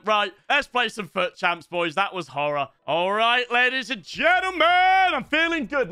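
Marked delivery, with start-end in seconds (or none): 2.9-5.9 hyped up. Real, not acted. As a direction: stretch it out, pumped for the match. Hyping himself before a match.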